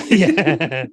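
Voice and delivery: laughing yeah. Real, not acted. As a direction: say it through a laugh. Laughing reply at the table.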